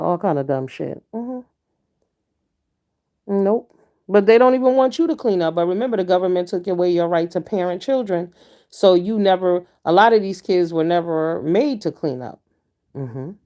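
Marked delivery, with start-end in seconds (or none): none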